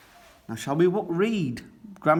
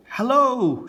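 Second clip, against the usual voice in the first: high, louder call of hello; shouting hello; 11 semitones higher, 5 dB louder than usual. A narrator's reading. Grampy Rabbit shouts hello to Peppa and George from his hovercraft. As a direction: shout it out across the water. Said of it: "shouts Grampy Rabbit".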